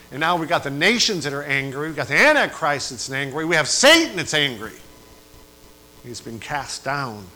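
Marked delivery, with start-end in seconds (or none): 0.1-4.7 louder on each line up to Satan; building fiery preaching. Acted. Preacher listing who is angry, building louder. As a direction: build it to a shout.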